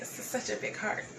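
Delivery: breathy whisper through tears; choked whisper. Real, not acted. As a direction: barely get the words out. Moved, speaking of big heart.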